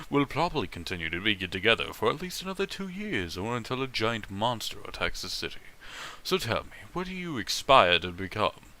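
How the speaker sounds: quiet, hushed reading of the fic; murmured fanfic reading; story narration. Acted. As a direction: read the story softly to the chat. Reads fanfic narration aloud.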